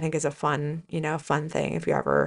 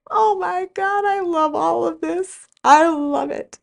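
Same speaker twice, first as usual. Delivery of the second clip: high, gushing delight, swelling louder at the end; thrilled; 12 semitones higher, 4 dB louder, slower than usual. Retold or imitated. Mom seeing her new car with a red bow, high thrilled gush. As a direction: squeal over the new car like a kid at Christmas.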